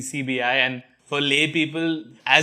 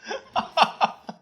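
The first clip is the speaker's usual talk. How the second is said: high burst of laughter; real laughter; 12 semitones higher, 5 dB quieter than usual. Real, not acted. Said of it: "Father, why are you laughing?".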